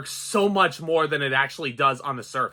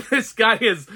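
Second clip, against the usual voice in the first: laughing; 6 semitones higher than usual. Real, not acted. Laughs while praising villain actor.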